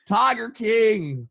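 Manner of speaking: slow, high, loud drawn-out call; mock shout. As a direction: call it out long across the water. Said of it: "will they go".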